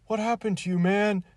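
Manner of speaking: high, loud mock-pathetic wail. Mocking the Star Wars actor's line, high and pathetic. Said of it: "he does it in a more, like, pathetic way".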